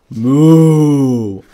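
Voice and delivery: loud, long moo; cow moo. Acted. Animals answer Wilford in turn.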